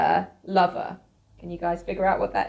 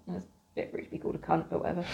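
whispered aside; 3 semitones lower, 12 dB quieter, much faster than usual. Real, not acted. Muttered aside.

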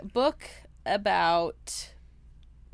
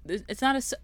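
ordinary talk

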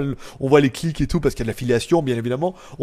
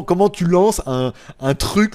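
ordinary delivery

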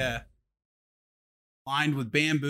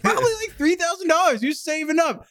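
much higher, hyped-up mock guess; imitating Charlie; 14 semitones higher than usual. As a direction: ham up his confident lowball. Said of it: "because he was like".

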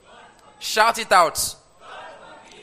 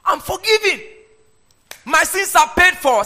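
excited; 8 semitones higher, 7 dB louder than usual. Acted. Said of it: "He's like".

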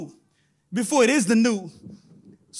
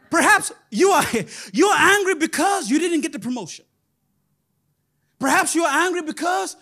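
louder, building preacher cry; rising preacher shout; 6 semitones higher, 4 dB louder than usual. Acted. Preacher to congregation, rising shout. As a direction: hit it hard, lifting the congregation.

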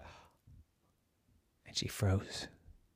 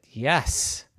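ordinary delivery, nothing marked

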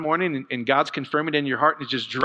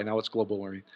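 global warming dropped softer and lower, dry; deadpan aside; 5 semitones lower, 9 dB quieter than usual. Shown as a demonstration. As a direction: mutter it with dry sarcasm. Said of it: "We used to call it July".

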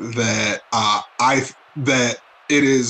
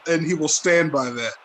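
ordinary delivery

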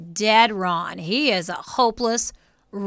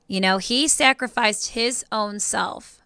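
ordinary talk, nothing marked

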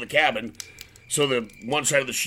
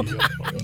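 high laugh burst. Real, not acted. Laughs at own joke.

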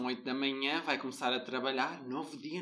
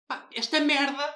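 a higher, louder mock snap of frustration; mock irritated curse; 11 semitones higher, 6 dB louder than usual. Friend imitating his irritated curse on the phone, mock snap. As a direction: toss off the curse in a hammed-up huff. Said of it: "Atiras assim um".

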